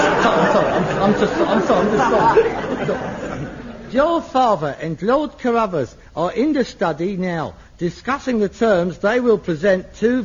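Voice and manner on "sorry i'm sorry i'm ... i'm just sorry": can't stop laughing while apologising; laughing apology. Real, not acted. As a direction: son dissolving into giggles mid-rehearsal.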